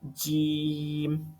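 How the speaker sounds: جیم long and softly pressed, with an echoing release; teacher's model of جیم; pressed jeem. Shown as a demonstration. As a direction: drag جیم out slowly. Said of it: "تو اس کو ہم ادا کریں گے".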